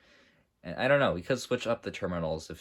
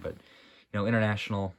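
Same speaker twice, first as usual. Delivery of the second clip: normal talking voice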